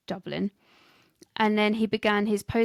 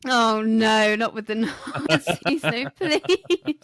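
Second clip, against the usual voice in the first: laughing protest; 5 dB louder, slower than usual. Real, not acted. Laughing protest at role play.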